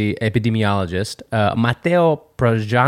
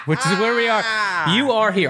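mock announcer; 9 semitones higher than usual. Mock map voice.

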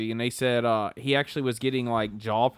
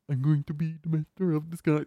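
odd, higher-pitched comic character voice; Dana Carvey impression; 4 semitones higher, 3 dB quieter than usual. Friend quoting Master of Disguise, silly character impression. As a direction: put on a silly movie-hero voice. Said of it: "Dana Carvey, Master of Disguise".

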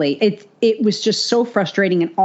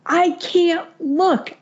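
much higher, slow mock whine; strained plea; 11 semitones higher, much slower than usual. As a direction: whine it slowly, eyes glued to the road. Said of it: "And I'm like".

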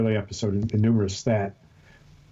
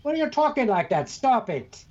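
much higher mock British scold; scolding wife; 8 semitones higher than usual. Imagined wife scolding him for talking British, high scold. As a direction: tell him off like an exasperated wife. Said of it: "My wife would say".

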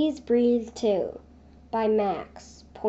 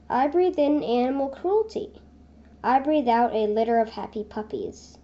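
ordinary talk, nothing marked

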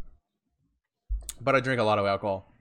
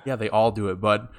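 ordinary talk, nothing marked

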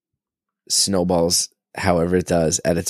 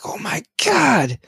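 high, slow awestruck gasp; amazed toddler; 13 semitones higher, slower than usual. Retold or imitated. Toddler seeing birds, amazed. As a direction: marvel like a small child. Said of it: "he's like".

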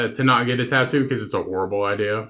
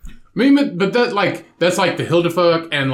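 excited; 5 semitones higher, 4 dB louder than usual. Real, not acted. Animated explanation.

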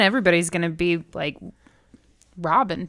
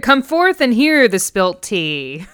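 slow, loud proclamation; drawn-out call to the street crowd; town crier; 3 semitones higher, 5 dB louder, much slower than usual. Pretend. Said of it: "someone shouting out from the streets".

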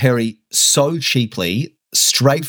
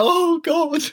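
high dismayed "Oh, God"; exasperated moan, pitched up; dismayed groan. Retold or imitated. Dismayed reaction quoted.

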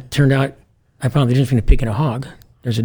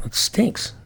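whispered excuse; 8 semitones higher, 7 dB quieter than usual. Retold or imitated. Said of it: "officers reluctant to search".

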